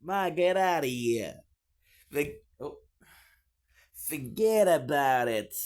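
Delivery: a playful New York Italian "forget about it"; Italian-American accent. Pretend. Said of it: "I don't know if you're Italian".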